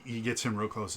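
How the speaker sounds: normal talking voice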